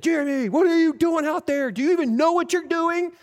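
yelling coach berating a player. Retold or imitated. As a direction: chew him out from the sideline. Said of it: "barking and yelling".

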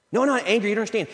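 a touch of hammed-up irritation; defensive denial. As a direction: insist you're not angry. Denying anger, defensively.

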